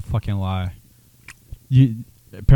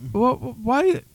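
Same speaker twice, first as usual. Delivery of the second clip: put-on whiny grown kid; 7 semitones higher than usual. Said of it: "and then it's like".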